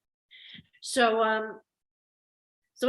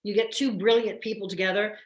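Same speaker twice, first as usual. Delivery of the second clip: usual speaking voice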